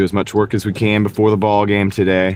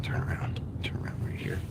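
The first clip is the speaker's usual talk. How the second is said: unvoiced whisper of directions; whispered turn instructions; 17 dB quieter than usual. Real, not acted. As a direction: breathe it out under your breath.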